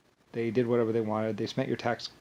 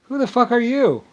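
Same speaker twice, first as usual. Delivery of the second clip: high, loud outraged shout; furious yell; 12 semitones higher, 9 dB louder than usual. Real, not acted. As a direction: blast the question at them. Furious rhetorical rant question.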